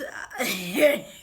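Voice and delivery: loud shrieking laugh. Real, not acted. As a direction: burst out laughing. Laugh heard.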